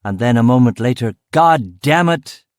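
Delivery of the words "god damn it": loud, high, drawn-out curse; fuming. A narrator's reading. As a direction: curse slowly and loudly, fuming. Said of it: "came out of the office fuming".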